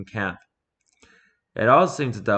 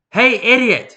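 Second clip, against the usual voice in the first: loud, much higher yell across the camp; calling out; 13 semitones higher, 10 dB louder, slower than usual. A narrator's reading. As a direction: shout it to get every gun's attention. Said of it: "he called".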